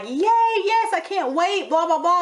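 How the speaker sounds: high, squealing mock giddiness; giddy. Imitating Nicole giddy on the phone, high and squealing. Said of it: "Nicole is just giddy and like".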